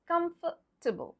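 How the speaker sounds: COMFORTABLE ending in a reduced tuh-bul; silent E. Shown as a demonstration. Teacher confirming for learners, COMFORTABLE as KUMF-tuh-bul. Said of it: "The E is not there".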